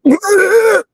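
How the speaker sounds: squealing burst of laughter; high laugh. Real, not acted. Laughs retelling gross moment.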